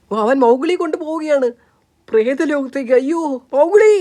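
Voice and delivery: rising, high panicked cry; frightened wail. Acted. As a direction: wail as the child is taken away. Mother sees child carried off.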